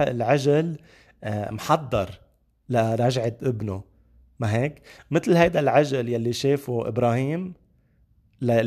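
usual speaking voice

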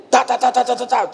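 rapid high-pitched knocking noise; imitated door knocking. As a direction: rap out sudden knocks with the voice. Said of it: "alguém bateu na porta da igreja". (imitação aguda de batidas na porta)

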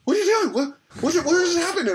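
loud, very high imitation of panic; retold freaking out. Wife alarmed at her husband's fit, imitated freaking out. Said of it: "freaking out".

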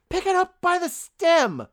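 high, loud, slow mock scolding; exasperated yell. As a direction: yell it at the screen in disbelief. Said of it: "and I was like".

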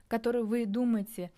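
usual speaking voice

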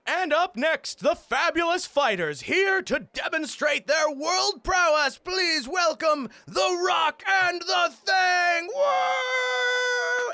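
high, loud ringmaster shout, slow and grand; circus announcer. Acted. GM voicing a ringmaster announcing the fighters, big shouted welcome. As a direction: boom it out to the crowd like a showman.

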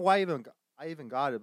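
ordinary talk, nothing marked

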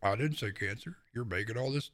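mocking his excuse. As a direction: lightly parody a defensive whiner. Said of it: "my response isn't".

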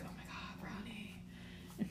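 hushed, whispered mock reverence; awed whisper. Two friends who hadn't eaten spot the brownie, awed whisper. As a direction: whisper it like a starving discovery.

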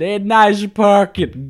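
a sneering driver, dragged out; mock Brooklyn sneer. Driver glares at her, slow sarcastic Brooklyn sneer. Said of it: "He said".

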